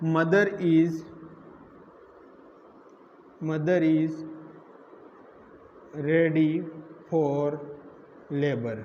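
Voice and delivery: one word at a time, long gaps; slow dictation. Real, not acted. Teacher writing out the condition for students, slow dictation.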